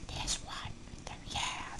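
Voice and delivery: soft aside whisper. Real, not acted. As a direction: whisper it quickly. Whispered aside while eating.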